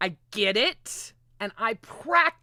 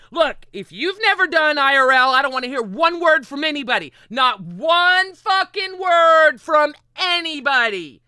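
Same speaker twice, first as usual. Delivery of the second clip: fiercely defensive; 7 semitones higher, 8 dB louder than usual. Real, not acted. Streamer warning chat off, louder and emphatic. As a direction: lay down the law to the critics.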